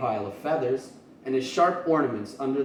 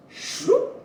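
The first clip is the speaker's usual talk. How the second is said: whooshing sound effect; 6 dB louder than usual. Acted.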